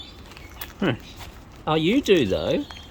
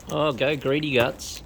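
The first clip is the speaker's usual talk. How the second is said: ordinary talk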